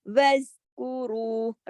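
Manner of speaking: وَاذْکُرُوا with a thick ر; heavy ra. Shown as a demonstration. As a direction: lips stretched for light letters, ra full. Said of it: "را موٹا پڑھا جائے گا". (وَاذْکُرُوا میں را موٹا)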